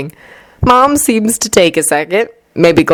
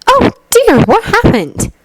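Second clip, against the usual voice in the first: higher, concerned mom voice; worried mother. A narrator's reading. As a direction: gasp it out with motherly worry. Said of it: "she asks, worried".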